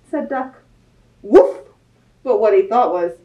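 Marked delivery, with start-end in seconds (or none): 1.2-1.6 a loud, higher "Woof!"; dog bark. Acted. Dog answering Duck with a loud bark. Animal noises in picture book.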